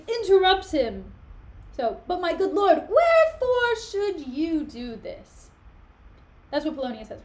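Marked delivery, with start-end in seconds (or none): 2.1-5.5 higher, drawn-out questioning voice; Rinaldo's slow pleading question. Acted. Servant pressing his lord with a slow, high question. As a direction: stretch it out, high and puzzled.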